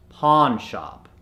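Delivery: pawn shop slowed, "ah" in both words; American accent. Shown as a demonstration. Coach gives the converted word, American accent. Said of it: "to the American accent".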